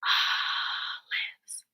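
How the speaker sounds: hushed, voiceless olives, first syllable stretched; whispered olives. Shown as a demonstration. Teacher asking pupils to whisper with her, whispered olives. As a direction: whisper olives in two separate beats. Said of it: "Whisper with me".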